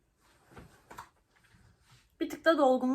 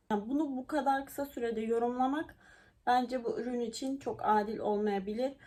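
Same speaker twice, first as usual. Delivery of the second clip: usual speaking voice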